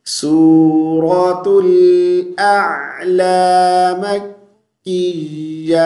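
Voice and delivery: slow, drawn-out melodic tilawat; Quran recitation. Acted. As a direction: recite slowly with a long melodic line. Recites surah name. (آہستہ، کھینچ کر، سریلی تلاوت)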